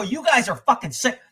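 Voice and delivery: retold angry tirade at a cowardly father; chewing him out. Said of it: "I fucking chewed his ass out".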